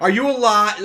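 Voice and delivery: high, loud mock-worried question; mock worried mom. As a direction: squeal it like a fretting mom. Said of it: "she's gonna think, like".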